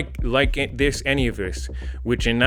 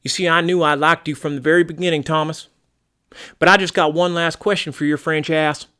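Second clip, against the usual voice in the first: chummy, drawling American homeowner; folksy American target; 2 semitones higher, 3 dB louder than usual. Acted. American target taking to Thomas the caller, folksy and chummy. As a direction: warm to the caller like a good old boy.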